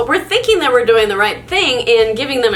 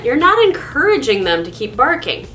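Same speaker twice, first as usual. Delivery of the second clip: ordinary delivery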